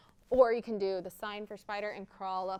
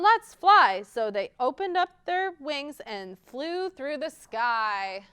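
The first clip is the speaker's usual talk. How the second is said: louder, lively chanted rhyme; children's rhyme; 3 semitones higher, 7 dB louder than usual. Acted. Librarian chants ladybug rhyme to children, lively. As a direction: chant it brightly for little ones.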